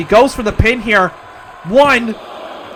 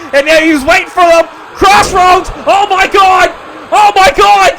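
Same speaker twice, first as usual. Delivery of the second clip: high, shouted commentator calls; frantic play-by-play; 11 semitones higher, 3 dB louder than usual. Acted. Commentator screaming through the near falls to viewers, frantic. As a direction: yell each count like the match is ending.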